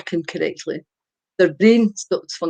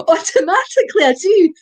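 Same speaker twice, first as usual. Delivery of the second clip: ordinary talk, nothing marked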